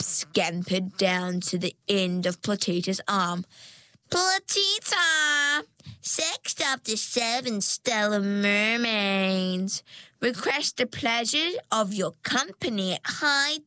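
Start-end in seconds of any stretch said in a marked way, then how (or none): 4.1-13.6 slow, high, pompous herald; grand announcement. Acted. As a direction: announce her like a court herald. Crab's announcement.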